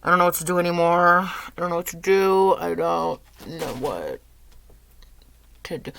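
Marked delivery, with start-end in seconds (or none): none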